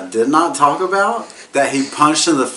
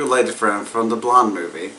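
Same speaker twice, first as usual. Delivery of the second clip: ordinary delivery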